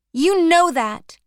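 loud, high, bitter; angry. Acted. Jake accusing Addie, "You know that." loud and high.

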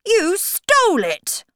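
high, loud royal shout; accusing shout. Acted. King accusing the Hatter, high loud shout. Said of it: "the king shouted".

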